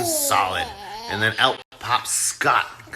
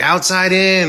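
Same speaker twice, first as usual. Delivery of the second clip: high, loud mock proclamation; called-out message; 9 semitones higher, 6 dB louder than usual. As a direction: imitate calling out a cryptic clue. Tangina's cryptic message acted out.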